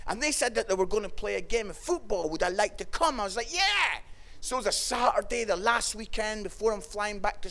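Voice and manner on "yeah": high, drawn-out eager yes. Retold or imitated. Student invited to play football, acting out eager delight. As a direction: leap up in pitch with keenness.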